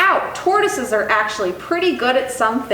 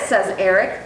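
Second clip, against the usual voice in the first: ordinary delivery, nothing marked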